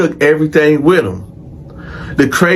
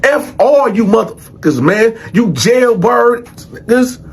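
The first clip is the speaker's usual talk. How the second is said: higher-pitched retold trash talk; talking big; 8 semitones higher than usual. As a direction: imitate cocky defiance toward the whole block. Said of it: "Jimmy just talking big shit".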